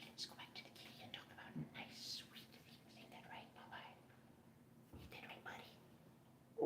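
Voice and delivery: breathy unvoiced whisper, slowing at the end. Owner whispering to the cat, voiceless and soft. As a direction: whisper it close and tender.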